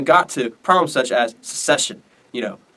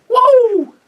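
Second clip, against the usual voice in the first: very high, loud "woo"; victory whoop; 8 dB louder than usual. Acted. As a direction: whoop in mock triumph. Jackson celebrating the Union, acted.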